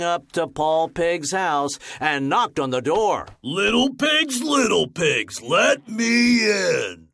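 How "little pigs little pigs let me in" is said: high, loud slow wolf call. Acted. Wolf at Paul's door, loud calling shout. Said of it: "said the wolf".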